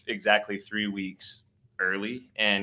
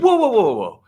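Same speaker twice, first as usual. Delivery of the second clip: high, loud imitated "whoa"; urgent halting cry; 12 semitones higher, 10 dB louder than usual. Midwife stopping dad about to cut, urgent mock cry. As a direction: call out to stop someone, high and loud.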